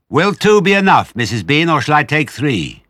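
lightly voiced Mabel, pushing her luck; hint of big servant woman. A narrator's reading. Mabel asks Missus Bean for the tail, eager.

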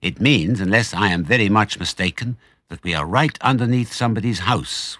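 plain reading voice

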